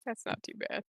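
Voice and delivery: soft whispered mimic of a friend; whispered imitation. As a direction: breathe it like a teasing echo. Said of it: "You're like".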